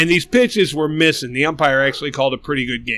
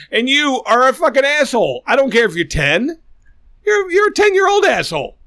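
rising heated outburst, pitch climbing higher; an angry rant at a whiny Little League pitcher; 8 semitones higher than usual. Real, not acted.

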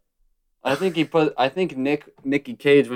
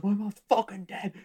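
high, softer imitated protest; mock upset heckler, pitched up; retold retort; 9 semitones higher, 5 dB quieter than usual. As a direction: protest it in a raised pitch, held-back volume.

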